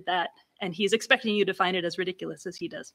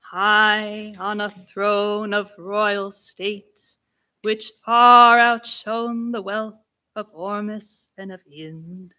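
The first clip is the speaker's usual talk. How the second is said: slow, level intoning on one pitch; stately poetic monotone; solemn monotone; 5 dB louder, much slower than usual. Shown as a demonstration. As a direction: intone it like ritual speech. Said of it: "for the solemn monotone".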